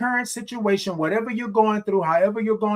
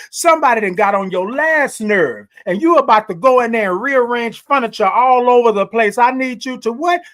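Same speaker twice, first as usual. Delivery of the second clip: loud, higher, building to a question; worked-up exhortation; 4 semitones higher, 5 dB louder than usual. Real, not acted. Host rouses listener about to rearrange furniture, exhorting. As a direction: preach it up to the cue.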